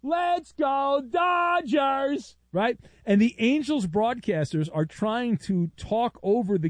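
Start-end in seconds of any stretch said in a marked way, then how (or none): 0.0-2.4 put-on high, slow kid's chant; little kid cheering behind home plate; cute little kid. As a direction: chant it like an eager eight-year-old fan. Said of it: "with his cute little kid voice is going".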